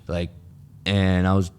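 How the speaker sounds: usual speaking voice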